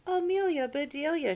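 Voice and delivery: loud, high cry of dismay; dismayed mistress crying out; cried out. A narrator's reading. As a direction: call her name in shock. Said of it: "she cried".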